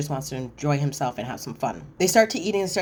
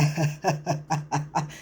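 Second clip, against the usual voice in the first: put-on staccato chuckle; imitated laugh. Retelling the boyfriend laughing off the question, imitated laugh. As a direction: act out his drawn-out laughter. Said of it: "Rich is laughing".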